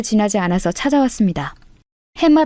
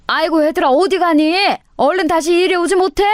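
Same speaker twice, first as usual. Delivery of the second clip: higher, pleading call; calling them back; 8 semitones higher than usual. Acted. Mother-in-law calls after the leaving daughters-in-law, high and pleading.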